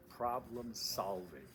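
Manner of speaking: solving drawn out slowly; slow, stretched solving; stress on solving. Shown as a demonstration. Instructor drilling solving for the class. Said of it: "I want to emphasize the second word".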